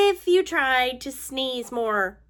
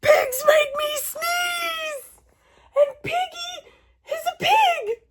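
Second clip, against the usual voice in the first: mock wailing; 12 semitones higher than usual. Gerald tells Doctor Cat pigs make him sneeze, hammed-up high wail. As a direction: wail it like a tragic child.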